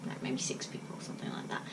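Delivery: quick hushed correction; whispered aside. Real, not acted. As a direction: drop to a whisper to downplay it. Quiet aside correcting herself.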